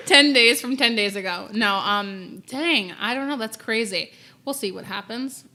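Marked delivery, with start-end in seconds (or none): 0.0-1.5 louder, words through laughter; laughing. Real, not acted. Laughing at the joke.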